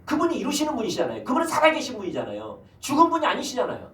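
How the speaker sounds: high, loud preaching cadence; fervent preaching. Acted. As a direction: preach it out to the congregation. Preaching at full force.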